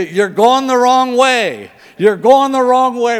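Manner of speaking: loud, high, drawn-out scolding; mock GPS scream. GPS screaming at driver who left for gas, mock scream. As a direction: holler it like an alarmed sat-nav. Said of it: "that thing screams at you".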